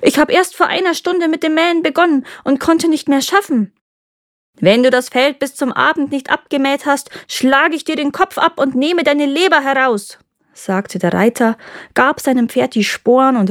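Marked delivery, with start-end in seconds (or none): 0.0-3.7 startled, high-pitched excuse; acted fright, pitch well up; frightened oath. A narrator's reading. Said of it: "Da erschrak der Faulpelz und schwor". 4.6-10.2 high-pitched, declaimed threat; story rider's stern warning, raised pitch; threatening. A narrator's reading. Rider threatens the lazy reaper.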